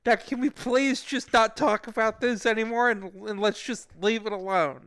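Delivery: high, loud, harried plea; re-enacting his rattled self; nervous pleading. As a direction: beg the caller to drop it. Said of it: "I was very nervous".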